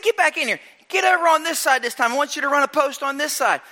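high, energetic instructions; coach calling out. Retold or imitated. Coach directing his player to the other side, high energetic calls. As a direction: call the player back in. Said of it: "I said".

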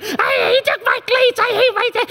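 very high crying whine; whining kids. Retold or imitated. Kids complaining in the minivan, high squabbling whine.

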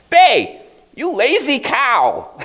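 high, loud, slow scolding yell; shrill scold. Acted. Mother yelling her lazy son awake, high, loud scolding. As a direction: scold him from across the house.

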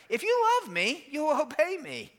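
light, affectionate Jesus. Shown as a demonstration. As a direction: say it like an invitation with a chuckle. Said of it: "That's how he says it. Like".

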